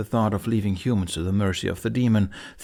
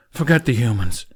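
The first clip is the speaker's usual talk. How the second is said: loud, angry snarl; 6 dB louder than usual. Acted. Toothless urging Hiccup to flee, loud snarl. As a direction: growl it at him, fed up. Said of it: "Toothless snarled".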